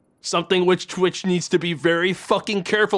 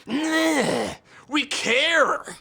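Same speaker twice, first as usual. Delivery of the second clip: high, put-on insincere voice; mock corporate caring; 8 semitones higher, much slower than usual. Streamer voicing Twitch to chat, mock-sincere. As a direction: play the company pretending to care, pitched way up.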